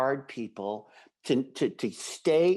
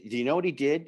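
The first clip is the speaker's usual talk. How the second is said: normal talking voice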